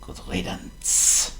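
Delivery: whispered, very slow good riddance; muttered aside. Acted. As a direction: breathe it under your breath. Really meaning.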